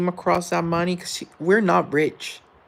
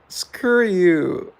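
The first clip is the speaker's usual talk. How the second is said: playful screw you; 4 semitones higher, 6 dB louder than usual. Pretend. Playful retort to chat.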